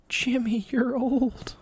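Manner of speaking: high squeaky quoted voice; high-pitched voice. Retold or imitated. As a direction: pitch way up for the line. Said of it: "He goes".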